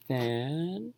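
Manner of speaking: Fantastic drawn out slowly, syllable by syllable; stretched out. Shown as a demonstration. Teacher stretching a word for students. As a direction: stretch Fantastic like an elastic band. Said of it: "Stretch out any word ... language slowly".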